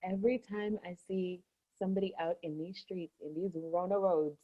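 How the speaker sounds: quiet, secretive; hushed confiding. Real, not acted. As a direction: lean in and keep it hushed. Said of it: "just between me and you".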